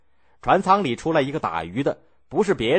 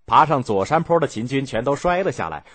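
ordinary delivery

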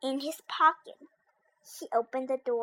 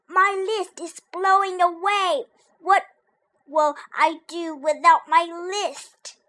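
panicked; 5 semitones higher, 6 dB louder than usual. Acted. Toad panics over lost list.

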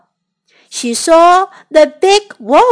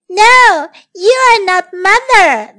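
put-on little kids' voice; 6 semitones higher, 5 dB louder than usual. Kids refuse the wolf.

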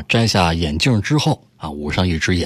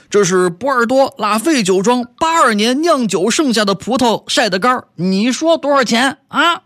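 high, loud indignant claim, slowing at the end; loud boast; 14 semitones higher, 3 dB louder than usual. Acted. Young man loudly tells the driver the price, high indignant boast. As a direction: proclaim it like a prized vintage. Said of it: "这年轻人大声说".

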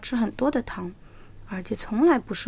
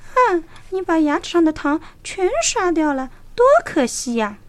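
coaxing fox; 7 semitones higher, 5 dB louder than usual. A narrator's reading. Fox persuades tiger.

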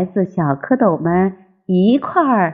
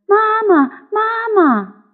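put-on high childlike call for mama; tadpoles calling; 13 semitones higher than usual. A narrator's reading. Tadpoles chase the turtle, calling high for mother. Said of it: "喊着".

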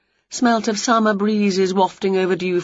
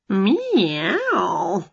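cat meow. Acted. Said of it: "said Wilbur".

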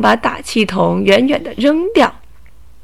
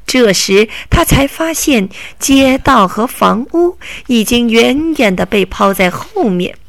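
plain reading voice

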